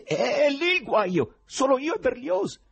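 high, loud frantic character voice; agitated outburst. Acted. Agitated Ivan answers in story.

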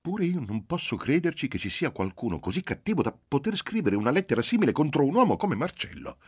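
high, earnest mother's voice, shaking her head in disbelief; sincere disbelief. Acted. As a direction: say it as if it's unthinkable. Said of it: "in tono di sincera incredulità". (madre incredula e sincera, voce alta)

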